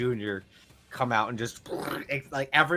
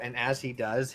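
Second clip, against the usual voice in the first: normal talking voice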